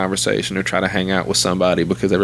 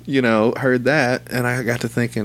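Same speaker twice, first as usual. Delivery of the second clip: ordinary talk, nothing marked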